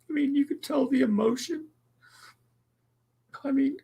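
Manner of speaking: high tearful pleading; crying. Real, not acted. Streamer begging chat to talk while crying, high voice. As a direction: plead through sobs, way up high. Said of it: "I promised I wouldn't get emotional".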